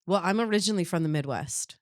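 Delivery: ordinary talk, nothing marked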